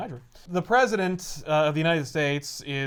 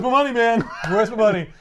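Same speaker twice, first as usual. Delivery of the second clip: raised, louder mock demand; 6 semitones higher, 8 dB louder than usual. Villain pressing the chief of staff, loudly demanding. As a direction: push louder, as if owed. Acted Osborn demanding his money.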